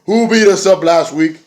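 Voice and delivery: calling out the attackers; mock call out. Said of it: "they say".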